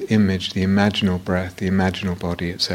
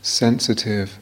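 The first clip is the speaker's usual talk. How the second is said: guided meditation. Real, not acted. Teacher leading students into body awareness, guided meditation voice. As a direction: guide them unhurriedly, leaving silence between phrases.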